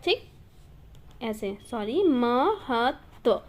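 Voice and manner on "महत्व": महत्व drawn out slowly as म, हत्, त्व; syllable by syllable. Shown as a demonstration. Teacher breaking the word up for students, slow correct pronunciation. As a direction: spell out महत्व slowly by sound, doubling the त. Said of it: "double आधा जो त है ना".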